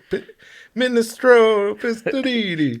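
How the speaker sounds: high, slow hammed-up Italian accent. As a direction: roll it out like a mock Italian. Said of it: "I was gonna do it in an Italian voice".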